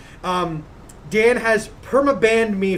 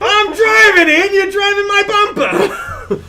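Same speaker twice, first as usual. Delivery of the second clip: slow, loud, much higher mock yell; angry driver yell; 11 semitones higher, 5 dB louder than usual. Chicago driver yelling at the car behind him.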